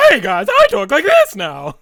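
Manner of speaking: loud, high, drawn-out silly voice; wacky voice. Acted. Covering a voice crack on purpose, loud wacky voice. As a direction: belt it out confidently in a goofy squawk. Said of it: "decided to do a wacky voice".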